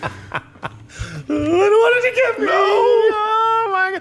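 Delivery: very high, slow wailing fright; mock terrified wail. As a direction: wail in hammed-up terror. Mocking scared caller, very high.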